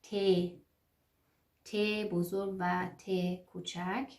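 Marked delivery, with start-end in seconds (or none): 0.0-0.1 ت said as "teh", with a long e; German letter name. Shown as a demonstration. Teacher giving learners the German letter, ت as "teh". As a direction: say ت as "teh", not "tee". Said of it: "تی نمیگیم".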